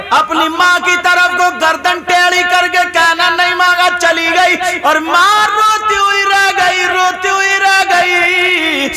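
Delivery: high, loud preacher's cry, drawn out; fiery preaching. Acted. Preacher warning the girls about disobedience, full force.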